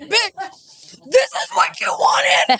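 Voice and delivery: slow, high, laughing shout; mock battle cry. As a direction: yell it out through laughter.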